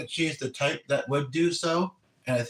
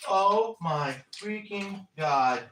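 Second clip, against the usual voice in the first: slow, drawn-out, high-pitched exclamation; real stunned disbelief, pitched up; astonished exclamation; 6 semitones higher, much slower than usual. Real, not acted. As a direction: stretch out each word in amazement. Sudden exclamation over found money.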